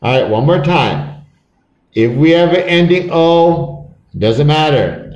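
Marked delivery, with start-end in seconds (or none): none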